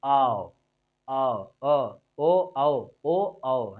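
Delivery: low, held "औ" set against "ओ". Shown as a demonstration. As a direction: round "ओ", then open it out to "औ". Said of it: "क्या बोलेंगे".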